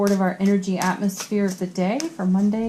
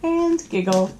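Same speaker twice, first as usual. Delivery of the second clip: said through a giggle, higher pitch; giggling; 5 semitones higher than usual. Real, not acted. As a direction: laugh the words out, embarrassed. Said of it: "I made myself blush".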